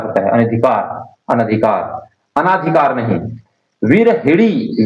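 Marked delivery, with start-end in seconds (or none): 3.8-4.8 विरहिणी said very slowly with a clear retroflex णी; correct spelling-out. Shown as a demonstration. Teacher to students, slowly spelling out the right word. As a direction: sound out विरहिणी syllable by syllable. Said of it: "विरहड़ी हम लोग पढ़".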